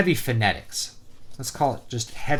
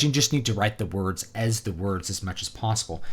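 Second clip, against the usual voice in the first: normal talking voice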